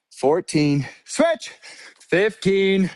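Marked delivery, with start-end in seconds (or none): 1.1-1.5 high, punchy coach cue; called-out cadence. Real, not acted. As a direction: call each switch to keep the class on beat. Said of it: "When I say switch, you switch".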